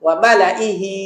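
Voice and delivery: ওয়া মালা ইহি with the alif silent, hamza kasra after lam; correct recitation. Shown as a demonstration. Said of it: "কি বলতে হবে?".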